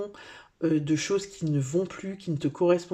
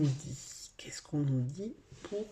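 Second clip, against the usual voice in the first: whisper; 8 dB quieter, slower than usual. Real, not acted. Reader musing to herself over cards.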